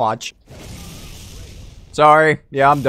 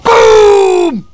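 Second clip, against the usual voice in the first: high, loud stretched victory yell; real gamer victory roar; triumphant shout; 10 dB louder than usual. Real, not acted. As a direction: slam the word down in triumph. Lands a hammer kill.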